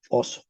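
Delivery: "oso" with a short single s; modern Spanish pronunciation. Shown as a demonstration. ("oso" en español moderno, con s breve)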